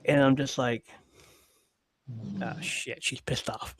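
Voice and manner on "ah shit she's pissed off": hushed to himself; under-the-breath dread; whispered realization. Retold or imitated. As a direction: whisper it like you're in trouble. Inner thought realizing trouble.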